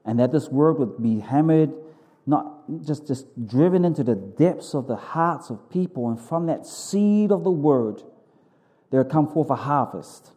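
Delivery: fervent, earnest pastor's prayer. Acted. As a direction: pray it out boldly to God. Leads the congregation in prayer.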